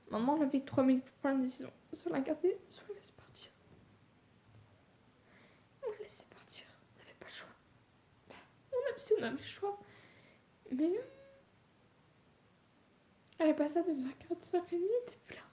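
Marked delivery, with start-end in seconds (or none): 1.9-7.5 crying, fading to a whisper; tearful, breathless whisper; snotty crying. Real, not acted. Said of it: "Je suis désolée si je pleure". 8.7-15.4 high, sobbing voice; openly crying; snotty crying. Real, not acted. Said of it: "Je suis désolée si je pleure".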